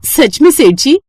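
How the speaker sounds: happily surprised. Acted. Lakshmi amazed at the praise.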